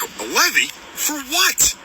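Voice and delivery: disbelieving, voice jumps up on what; incredulous. Retold or imitated. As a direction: hit for what with outrage. Retelling call to bank, outraged disbelief.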